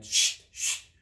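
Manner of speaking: sharp unvoiced hush; shushing. A narrator's reading. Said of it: "the Hatter and the March Hare went".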